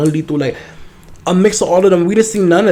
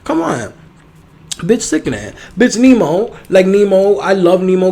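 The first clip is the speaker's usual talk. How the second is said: ordinary talk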